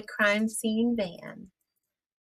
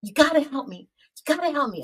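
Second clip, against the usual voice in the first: retold yell; 7 semitones higher, faster than usual. Man running from a car begs her for help, mock yell. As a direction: cry for help in a half-acted yell.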